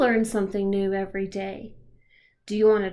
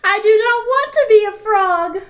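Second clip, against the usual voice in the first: much higher, much louder protest; upset; 10 semitones higher, 11 dB louder than usual. Acted. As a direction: cry it out in dismay. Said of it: "He's really upset".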